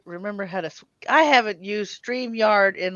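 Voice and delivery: usual speaking voice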